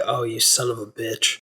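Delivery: low, hushed mock anger; quietly pissed. Imagining Magneto's reaction to being outwitted, quietly pissed. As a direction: imitate seething under the breath. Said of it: "he's fucking pissed".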